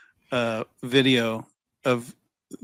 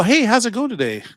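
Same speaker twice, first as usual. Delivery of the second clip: much higher, bright sales greeting; cheery telemarketer; 10 semitones higher than usual. Retold or imitated. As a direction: greet like an upbeat robot caller. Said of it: "they'll start going".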